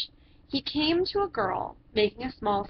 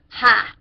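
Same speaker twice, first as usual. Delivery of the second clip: loud scornful ha; mocking ha; 8 dB louder than usual. A narrator's reading. Judge scoffing at Matthew's sand house. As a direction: bark a loud mocking laugh. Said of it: "said the judge".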